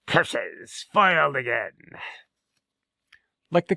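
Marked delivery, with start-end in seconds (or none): 0.1-1.7 a hint of a sneering villain, grumbled; defeated villain. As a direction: curse the defeat. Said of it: "the villain".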